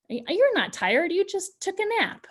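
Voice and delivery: high-pitched, incredulous brush-off; dismissive parent. Acted. Teacher answering a tired child, dismissive. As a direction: contradict the child, pitch jumping up. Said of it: "this is dismissing their feelings".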